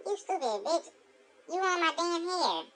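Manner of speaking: high-pitched indignant insult; mock outrage. Captive to the attacker who glued hair on, mock outrage.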